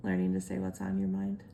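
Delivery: dry, lightly sarcastic repeat. Real, not acted. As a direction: say it with a little sarcasm. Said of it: "Are you being sarcastic?".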